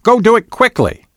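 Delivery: very high-pitched urging; insistent. A narrator's reading. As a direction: hurry them along insistently. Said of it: "Bonnie insisted".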